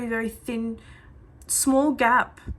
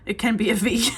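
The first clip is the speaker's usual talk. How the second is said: laughing; 7 dB louder than usual. Real, not acted. Laughs at her own stretch.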